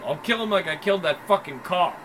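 ordinary delivery

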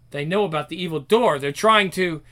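plain reading voice

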